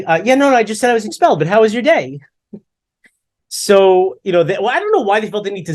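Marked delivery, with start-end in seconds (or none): 0.0-2.2 high-pitched breezy brush-off; mock casual dodge. Son repeating news to parents, high mock nonchalance. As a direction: gloss over it in a raised voice. Son dodging on retold call.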